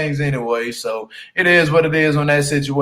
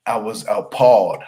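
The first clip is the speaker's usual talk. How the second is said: low, soft, dazed voice; dazed choked guy; 8 semitones lower, 4 dB quieter than usual. Retold or imitated. Imitating the choked-out man, low and dazed. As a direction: mumble like a man just woken from a chokehold. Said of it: "He's like".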